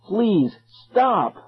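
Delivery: high, desperate plea; pleading. A narrator's reading. Two lost kids to dancers, pleading. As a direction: plead urgently, each word apart. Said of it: "Ben and I both pleaded".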